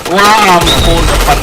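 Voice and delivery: loud high startled cry; alarmed. Real, not acted. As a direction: yelp as an enemy pops up. Enemy suddenly in front.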